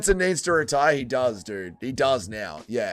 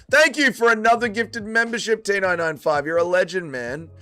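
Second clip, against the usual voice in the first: raised, energetic shout-out; hyped thanks; 5 semitones higher than usual. Real, not acted. Streamer thanking a viewer for another membership, hyped. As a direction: burst in with higher-pitched gratitude.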